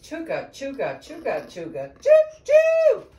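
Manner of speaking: chug building to a high whistle; train call. Acted. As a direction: chug along, then blast the choo choo. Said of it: "make the call of a wild train".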